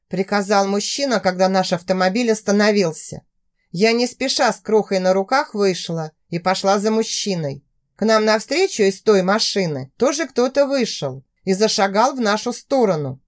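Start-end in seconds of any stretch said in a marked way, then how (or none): none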